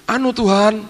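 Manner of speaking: a servant hurriedly begging pardon; humble apology. Retold or imitated. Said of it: "ini pembantu ngomong gini".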